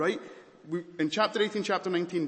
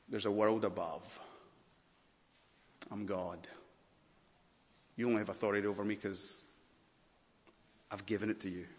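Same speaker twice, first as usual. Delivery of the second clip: quiet authority; 3 semitones lower, 6 dB quieter, faster than usual. Acted. Voicing Jesus quietly.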